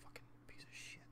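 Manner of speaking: unvoiced hissed whisper; whispered curse. Real, not acted. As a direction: whisper the curse under your breath. Gamer curses under breath.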